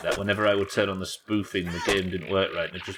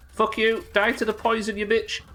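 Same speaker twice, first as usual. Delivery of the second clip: angry; 13 semitones higher than usual. Real, not acted. Cursing the chasing monster.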